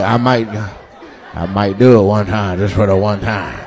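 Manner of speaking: low, coy mock admission; lightly voiced reluctant confession, kept low; imitating audience member. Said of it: "You like".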